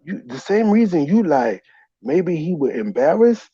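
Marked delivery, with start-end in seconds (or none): none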